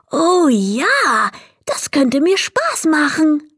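high, eager little kobold; happy agreement, pitched well up; delighted. A narrator's reading. Kobold offered shoes to polish, suddenly delighted. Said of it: "konnte plötzlich wieder lachen".

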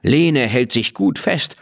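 ordinary delivery

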